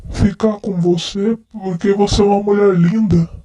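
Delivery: lightly slurred, tipsy declarations of love; drunk man. Acted. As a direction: play a drunk guy sweet-talking his friend. Nossa, eu tô muito bêbado.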